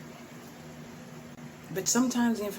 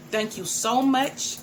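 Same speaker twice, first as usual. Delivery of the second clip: drawn-out louder thank you; slow heartfelt thanks; 5 semitones higher, 5 dB louder, much slower than usual. Real, not acted. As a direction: stretch it out with real gratitude. Thanking viewers.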